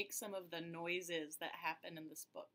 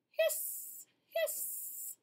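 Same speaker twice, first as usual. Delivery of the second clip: much higher, airy whistling hiss; kettle hiss; 4 dB louder than usual. Acted. Narrator voicing the whistling tea kettle, high hiss. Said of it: "The tea kettle whistled".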